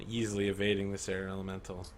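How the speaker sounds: ordinary talk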